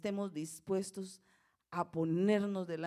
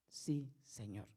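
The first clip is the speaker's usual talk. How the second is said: low, soft, slow assent; humble reply; 7 semitones lower, 6 dB quieter, slower than usual. A narrator's reading. Blind men answering Jesus, low humble assent. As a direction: answer quietly and slowly, submissive. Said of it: "Y ellos le respondieron".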